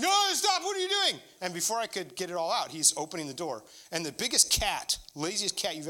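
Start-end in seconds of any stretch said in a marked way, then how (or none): 0.0-1.2 high, loud alarmed shout; retold shriek of alarm, much higher and louder; panicked cry. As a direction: yell it like you're scared of what's behind the door. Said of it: "And I was like".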